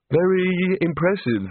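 slow, sighed-out remark; sighing. Acted. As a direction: say it slowly on a sigh. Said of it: "sighed Rincewind".

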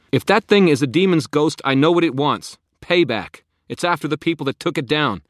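loud yell down stairs. Acted. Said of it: "I yelled back".

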